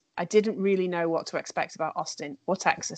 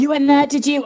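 surprised; 8 semitones higher, 9 dB louder than usual. Real, not acted. Surprised at shared restaurant.

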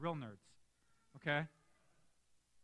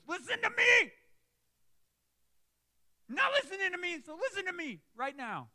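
high, loud urgent shout; 12 semitones higher, 8 dB louder than usual. Shown as a demonstration. Prophet shouting at hard-pressed people to listen, loud. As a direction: grab them by the collar with it. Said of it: "He goes".

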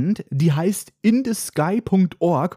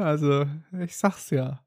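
usual speaking voice

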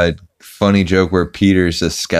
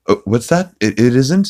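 higher cartoon child voice, questioning; Stewie; 6 semitones higher than usual. Stewie answering his toy Rupert, mock child voice. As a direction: imitate a cartoon baby taken in by a trick. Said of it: "Stewie is playing with Rupert".

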